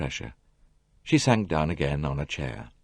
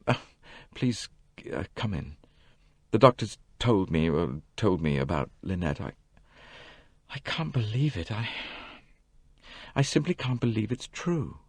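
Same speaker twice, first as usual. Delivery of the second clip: softer, halting and dazed; muttered; 3 semitones higher, 5 dB quieter than usual. A narrator's reading. Simon lying injured, greeting Poirot in a dazed mutter. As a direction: mumble through the shock. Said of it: "He muttered".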